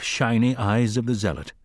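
plain reading voice

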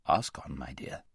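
low, kindly permission; gentle lion. A narrator's reading. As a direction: invite her softly, deep and calm. Lion gently invites girl's question.

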